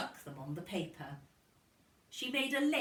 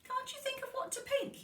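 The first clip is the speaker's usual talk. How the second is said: Missus Kelly coaxing; faster than usual. A narrator's reading. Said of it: "asked Missus Kelly".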